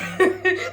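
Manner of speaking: short giggle breaking into the story; brief high laugh; amused laugh. Real, not acted. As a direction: crack up before the punchline. Cracks up before quoting her.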